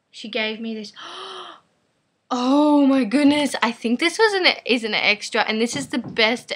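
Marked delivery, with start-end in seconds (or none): 1.0-1.5 high, sharp gasp of surprise; delighted gasp. Real, not acted. Finds a surprise extra. 2.3-4.5 overjoyed. Real, not acted. Finds a surprise extra.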